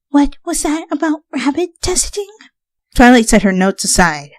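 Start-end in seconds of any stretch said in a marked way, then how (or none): 0.1-2.3 Fluttershy alarmed. A narrator's reading. Fluttershy asks Twilight about rabbit testing. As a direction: gasp it out timidly.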